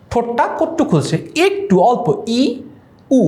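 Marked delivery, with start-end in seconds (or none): 2.4-3.2 ই then উ said as isolated, clearly shaped vowels; separate, careful vowel sounds ই and উ; vowel demo. Shown as a demonstration. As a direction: model ই and উ slowly for the class. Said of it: "দেখেছ?".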